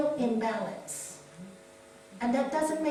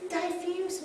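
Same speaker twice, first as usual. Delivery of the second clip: ordinary talk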